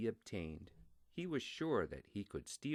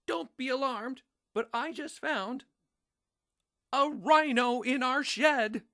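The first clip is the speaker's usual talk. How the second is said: much higher and louder, calling across the house; alarmed mom calling out; 12 dB louder than usual. A narrator's reading. Mom calling to the family about a rhino in the shed, high and loud. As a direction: call out in pretend calm that cracks into alarm. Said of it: "Mom called out and said".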